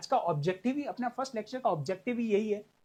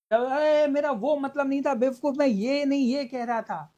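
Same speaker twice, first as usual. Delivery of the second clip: loud, raised-pitch exasperated protest; indignant acted outburst, pitched up; irritated quarrel; 5 semitones higher, 5 dB louder than usual. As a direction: snap back loudly in a mock argument. Acting out a texting quarrel.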